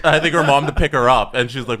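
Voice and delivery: ordinary delivery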